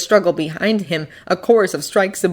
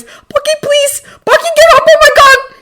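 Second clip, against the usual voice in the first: very high, frantic begging; panicked tearful cries; desperate, watery plea; 8 dB louder than usual. A narrator's reading. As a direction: beg tearfully, growing louder. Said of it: "A voice, a desperate, watery voice".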